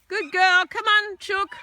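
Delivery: high, loud praise to the dog. Real, not acted. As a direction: cheer the dog on.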